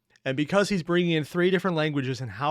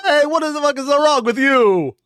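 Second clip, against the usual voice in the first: loud, much higher hammed-up Italian-American mobster shout; mock Italian-American yell; 12 semitones higher, 10 dB louder than usual. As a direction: bellow like an angry Boston Italian-American uncle over a cannoli. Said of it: "while Italian is more of the".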